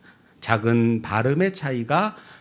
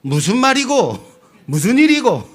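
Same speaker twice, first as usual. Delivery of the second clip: loud, high Gyeongsang phone chatter; Gyeongsang dialect; 3 semitones higher, 6 dB louder than usual. Acted. Seoul resident taking a call from home, broad Gyeongsang dialect. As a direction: imitate a Gyeongsang native slipping into dialect. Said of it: "자기는 사투리 안 쓴다고 말해요".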